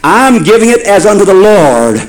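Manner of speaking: high, driving sermon delivery; impassioned preaching. Real, not acted. Preacher urging the congregation to forgive, impassioned and high. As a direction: preach it full out, pitched high.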